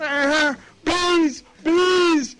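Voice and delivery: high, loud screams of alarm; hammed-up frantic cry, far above normal pitch; mock panicked yelling. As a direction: yell like you're fleeing a swarm. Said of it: "start yelling, "Bees"?".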